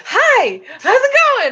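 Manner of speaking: very high, loud imitation; hammed-up squeal of cheer; mock perky greeting. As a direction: greet like an overexcited morning person. Imitates housemate barging in cheerfully.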